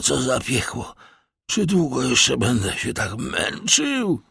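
high, suffering voice of a dying man; agonised lament. A narrator's reading. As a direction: moan through the pain, slowing as it worsens. Dying servant laments his agony. (wysoki, udręczony głos konającego)

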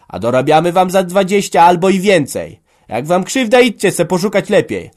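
loud, high-pitched angry shouting; heated quarrel. Acted. Family argues over land and labour, shouting angrily. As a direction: hurl grievances back and forth at full voice.

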